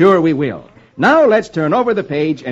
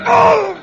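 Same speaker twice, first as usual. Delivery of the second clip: loud high cry; sudden high yelp, acted; cry of being hit; 6 dB louder than usual. Bowman toppled by an arrow, high cry.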